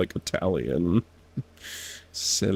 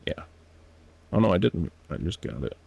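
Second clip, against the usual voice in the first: usual speaking voice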